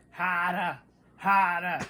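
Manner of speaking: mock Boston accent, dropped R's. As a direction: imitate a movie Bostonian saying "hahdah". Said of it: "Ben Affleck is doing a Boston accent in a movie".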